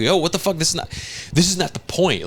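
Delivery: high-pitched indignant fan complaint; put-on indignation, high but not shouted; mock outrage. Voicing outraged viewers of show.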